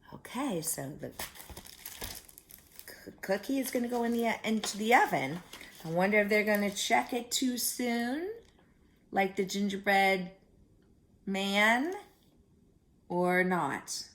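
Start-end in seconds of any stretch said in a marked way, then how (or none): none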